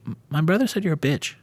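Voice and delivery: a hint of a bratty kid taunting; faint kid voice trying to fool the stranger online; little kid voice. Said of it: "I'm gonna try to do it right now".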